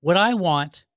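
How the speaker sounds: ordinary delivery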